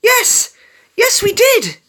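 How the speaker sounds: very high, loud jubilant yes; gleeful celebrating yell; cheery shout. A narrator's reading. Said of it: "Dash shouted cheerily".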